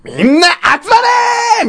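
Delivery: high, slow drawn-out yell; hammed-up summoning shout down the hallway; loud yell. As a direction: imitate an older kid hollering to gather everyone. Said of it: "今まで聞いたことのないような大声で".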